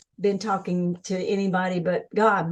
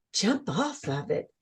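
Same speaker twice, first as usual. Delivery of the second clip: soft, stunned question; mild gasp of disbelief at the order; shocked disbelief; 3 semitones lower, 7 dB quieter than usual. Retold or imitated. Speaker recalling her stunned reply to the inner voice.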